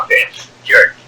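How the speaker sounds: usual speaking voice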